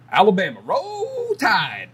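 voice cracking up an octave. Real, not acted. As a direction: voice cracks high on Roll Tide. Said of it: "my voice cracked right there".

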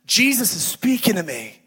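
lightly voiced child calling out to Daddy; excited little girl. Little girl sits up in bed to tell Daddy, mildly excited child voice. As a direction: announce big news like a small kid. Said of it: "she leaned up in the bed. She goes".

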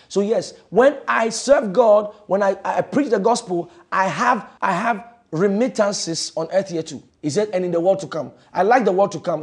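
loud, rising preacher's call; fiery preaching. Acted. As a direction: preach it out to the congregation. Preaching at full force.

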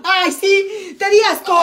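high, loud sarcastic retort; indignant high-pitched jab; mock offence. Real, not acted. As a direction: throw it back, loudly offended. Mock offence at partner's disgust.

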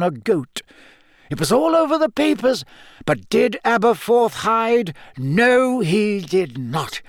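put-on high-pitched, emphatic old headmaster; firm headmaster. Headmaster reassuring his gamekeeper, firm and insistent. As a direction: press the point as headmaster. Narrator voices Dumbledore.

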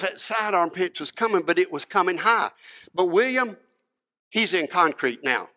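normal talking voice

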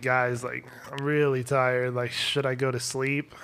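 quietly imitating a groggy, concussed self; tired and dazed. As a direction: drift off as you ask. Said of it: "And I was like".

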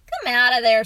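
loud parental order; firm calling out. A narrator's reading. Father ordering boy out from under covers, loud and firm. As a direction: call out loudly to a hiding child.

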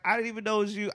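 high mocking woman voice; woman's excuse, mimicked in a high voice.

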